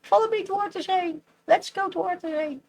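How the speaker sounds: high, fast, playful sing-song; sing-song call. Acted. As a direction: chant it like a happy rallying call. Sing-song repeat of raid call.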